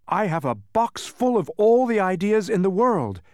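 high, loud proud announcement; proud Milo. A narrator's reading. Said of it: "said Milo proudly".

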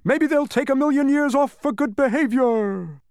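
humbug shouting. A narrator's reading. Humbug shouting after the prisoners, high encouraging call. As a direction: call out encouragement at the top of your range. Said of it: "shouted the humbug".

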